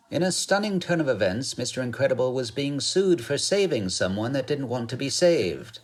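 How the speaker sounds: put-on old-timey newsreel announcer; bright, high fifties news read; 1950s narrator. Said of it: "a news voice of The Incredibles".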